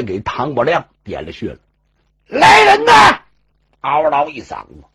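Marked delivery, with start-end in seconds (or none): 2.3-3.2 sudden high yell for the servants; loud alarmed cry of the householder; shouting for help. Acted. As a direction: bellow it out to call the men. Storyteller voices alarm call.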